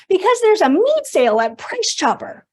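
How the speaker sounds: bright, eager mother announcing a sale; mom's voice. Retold or imitated. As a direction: mom thrilled over a bargain. Mom voiced in retold call.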